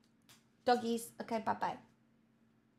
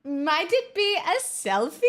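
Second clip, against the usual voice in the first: normal talking voice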